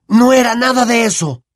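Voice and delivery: a sudden, lightly voiced boy's protest; Harry cutting in. Harry interrupts his aunt, mild sharp protest. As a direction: break in sharply. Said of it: "interrumpió Harry de repente".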